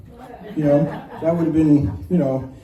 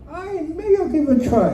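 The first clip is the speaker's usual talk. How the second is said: high-pitched, hesitant offer to have a go; lightly acted would-be volunteer, voice lifted; tentative volunteering; 9 semitones higher than usual. Nervous first-timer deciding to try the ride, tentative volunteering.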